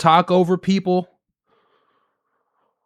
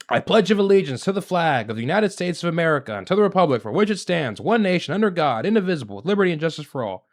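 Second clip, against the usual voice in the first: quick, softer pledge recital; rote recitation; 4 dB quieter, faster than usual. Acted. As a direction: rattle it off by rote like an empty formality. Mockingly recites the pledge as formality.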